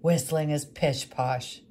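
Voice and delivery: put-on gruff woodcarver scoffing at the boy; gruffly. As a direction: brush the boy off like a grumpy old man. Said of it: "said the woodcarver gruffly".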